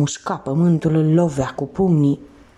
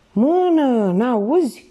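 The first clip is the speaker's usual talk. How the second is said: high, insistent command; impatient, raised cry; urging on; 8 semitones higher, 3 dB louder, much slower than usual. A narrator's reading. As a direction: push him to keep going, pitched up. Thief urges exhausted swimmer on.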